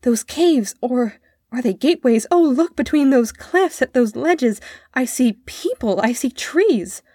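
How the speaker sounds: excited wonder. A narrator's reading. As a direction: marvel at a sight just spotted. Boy excitedly describing the view.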